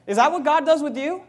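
ordinary talk

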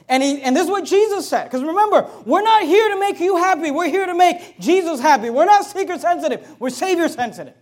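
loud, rising preacher's call; full-force preaching. Real, not acted. Pastor rousing his church, preaching loud and high. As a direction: drive it home from the pulpit.

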